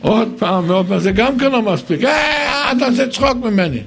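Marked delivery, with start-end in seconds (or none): 2.0-3.8 high, quick scoffing wife's retort; fast, high mock offence; mock offended wife. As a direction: toss it off like a wife who feels mocked. Wife's scoffing reply voiced.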